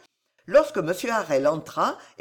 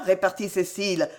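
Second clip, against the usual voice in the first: plain reading voice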